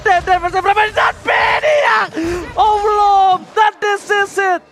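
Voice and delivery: high, slow hype shout; fighting game announcer. Real, not acted. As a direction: scream the big play call. Esports caster peak hype call.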